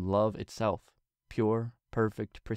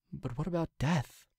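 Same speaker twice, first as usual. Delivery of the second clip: higher, hesitant; Twilight's small question; 7 semitones higher, 3 dB quieter than usual. A narrator's reading. Twilight timidly questions the king, higher. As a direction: ask it timidly.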